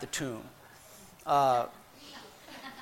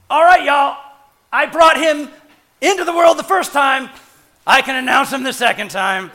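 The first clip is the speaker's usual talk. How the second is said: loud, higher, brash angel bragging to the crowd; swaggering Gabriel; 5 semitones higher, 11 dB louder than usual. Pretend. Gabriel boasting to the guards about the birth, loud and brash. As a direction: announce it like a cocky showman angel. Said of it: "I imagine Gabriel going".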